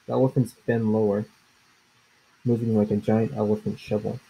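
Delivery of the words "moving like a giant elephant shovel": plain reading voice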